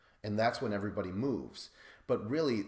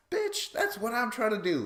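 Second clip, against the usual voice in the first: high, loud mock-exasperated yell; pissed-off outburst; 14 semitones higher, 7 dB louder than usual. Podcaster voicing an unheard contestant, high exasperated outburst. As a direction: imitate him blowing up in squeaky indignation. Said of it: "[name] truly is getting pissed".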